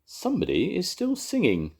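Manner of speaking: high, lightly voiced Welsh choirman; a touch of a high excited voice; Evans. A narrator's reading. Narrator voicing Evans noticing the singing, high and light. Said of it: "said Evans".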